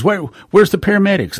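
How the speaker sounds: high, hurried demand for help; urgent demand. Retold or imitated. Bystander asking after the paramedics, urgent and impatient.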